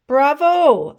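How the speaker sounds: loud, high bravo; teacher's loud cheer; delighted praise. A narrator's reading. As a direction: cheer them on.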